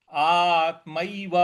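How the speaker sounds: आत्मैव slowly, ऐ drawn out; slow ऐ sound. Shown as a demonstration. Teacher modelling the vowel slowly for students. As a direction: stretch the ऐ in आत्मैव. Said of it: "इसको ऐसे बोलेंगे".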